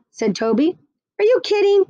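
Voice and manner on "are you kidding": put-on incredulous kid. A narrator's reading. Kid scoffs at dad.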